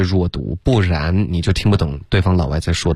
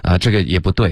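ordinary talk, nothing marked

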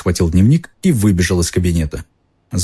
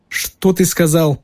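incredulous; 10 semitones higher, slower than usual. A narrator's reading. Malfoy disbelieving.

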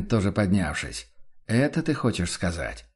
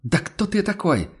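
high-pitched indignant outburst, not shouted; indignant cry; 8 semitones higher than usual. A narrator's reading. Locked-out traveller challenges the man behind the door, indignant. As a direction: cry out in affronted disbelief, pitched up. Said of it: "возмущённо выкрикнул голос".

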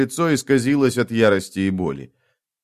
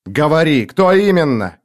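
raised, insistent demand; sharp interrogation; 5 semitones higher, 4 dB louder than usual. A narrator's reading. Gang leader pressing the survivor for names, sharp interrogation. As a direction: press for the name, pitched up.

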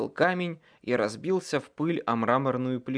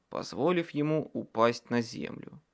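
ordinary delivery, nothing marked

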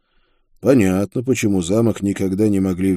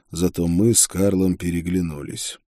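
plain reading voice

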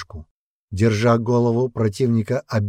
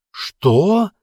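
high, louder startled question; shocked cry; 11 semitones higher, 5 dB louder than usual. A narrator's reading. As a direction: cry out in disbelief. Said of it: "вскрикнул Ули".

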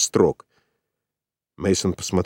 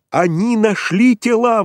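much higher and louder, drawn out; excited exclamation; 13 semitones higher, 4 dB louder, slower than usual. A narrator's reading. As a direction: burst in with the news. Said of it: "воскликнул он". (взволнованное восклицание)

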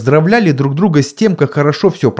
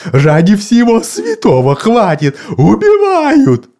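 panicked cry; 10 semitones higher, 4 dB louder than usual. A narrator's reading. Mr Wilkes panicking.

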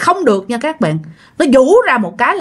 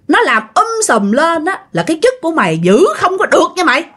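higher-pitched acted menace, no full shout; confrontational, pitched up, mock aggression; retold threat; 6 semitones higher than usual. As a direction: taunt and threaten in an imitated angry voice. Possessed soldier threatens and taunts squad leader.

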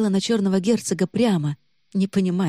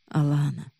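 caring warmth; 2 semitones lower, 4 dB quieter than usual. A narrator's reading. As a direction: say her name with care. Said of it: "в его голосе плескалось заботливое тепло".

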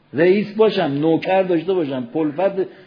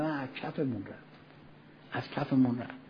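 much softer, lower lament; regretful sigh; 4 semitones lower, 17 dB quieter than usual. Retold or imitated. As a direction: mourn what slipped away. Mourning the lost night prayer.

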